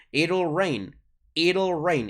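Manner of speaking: "It'll" said as "iRl", T as a tapped R; flapped t. Shown as a demonstration. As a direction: repeat "It'll" with a light tapped R. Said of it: "La R es como una R más bien".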